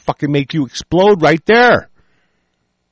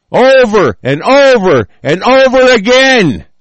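slow, raised, drawn-out yelling; angry emphasis; 4 semitones higher, 4 dB louder, slower than usual. Real, not acted. Speaker rants about treason committed repeatedly, angry emphasis. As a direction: hammer each repeat, high and drawn out.

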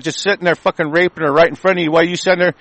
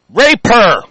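angry outburst; 4 semitones higher, 6 dB louder than usual. Real, not acted.

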